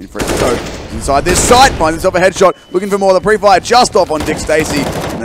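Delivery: hyped esports commentary; excited play-by-play. Real, not acted. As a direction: call the action as it climbs.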